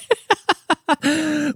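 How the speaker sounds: real fit of giggles mid-story; cracking up. Real, not acted. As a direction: break into a long run of high laughter. Cracks up telling of hysterical laughing.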